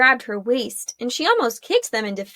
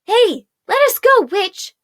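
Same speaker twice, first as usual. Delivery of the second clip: high, loud demand; shouting; 9 semitones higher, 7 dB louder than usual. Acted. A prisoner yelling at Arella, loud and high. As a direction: yell it at the witch. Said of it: "a woman shouted".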